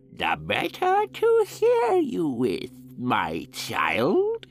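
very high, slow fake granny; wolf squeaking as an old lady; wolf as grandmother. A narrator's reading.

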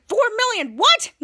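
high, loud incredulous question; mock astonishment, voice shooting upward; retold shock. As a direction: imitate disbelief, leaping up on the last word.

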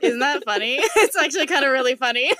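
laughing while talking. Real, not acted. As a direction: giggle through the words. Laughing at astrology joke.

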